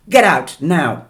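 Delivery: loud, high shout. A narrator's reading. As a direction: bark the order out. Angry driver ejects passengers.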